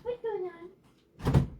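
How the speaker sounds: high, slow sing-song pet voice; talking to a dog. Real, not acted. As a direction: coo at the dog, high and drawn out. Greeting pets after going to door.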